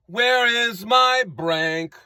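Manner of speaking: very slow, drawn-out question; singer's slow opening line, quoted. Retold or imitated. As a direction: stretch out Where is my Brank. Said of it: "and I quote".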